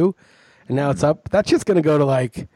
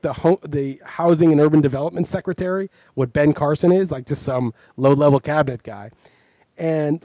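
normal talking voice

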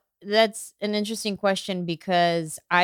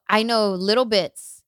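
ordinary talk

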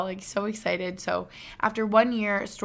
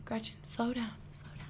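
parents scolding; 2 semitones higher, 12 dB quieter than usual. Retold or imitated. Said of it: "My mom and dad would tell me".

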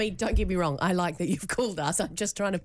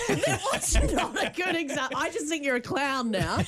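laughing through the words; 7 semitones higher than usual. Real, not acted. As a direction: giggle while you talk. Laughing at the example.

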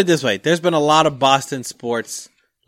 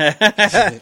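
ordinary talk, nothing marked

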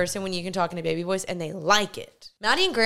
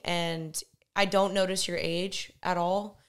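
ordinary talk, nothing marked

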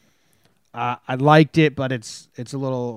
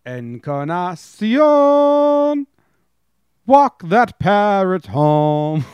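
slow, higher announcer call; home run call; 4 semitones higher, 4 dB louder, much slower than usual. Retold or imitated. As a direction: stretch it out like a broadcaster.

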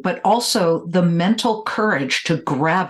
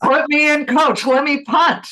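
higher, louder mock plea to the coach; eager quarterback; 8 semitones higher, 3 dB louder than usual. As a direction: beg the coach to let you punt. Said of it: "you step up and say".

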